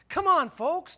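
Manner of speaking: high, loud mock-exasperated son; Jesus. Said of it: "Jesus said to Mary and Joseph, he said".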